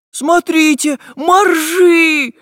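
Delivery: very high, loud squeal of delight; overjoyed shout. A narrator's reading. Prutik spots walruses, overjoyed high shout. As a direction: cry out the discovery, stretching the last word. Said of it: "обрадовался Прутик".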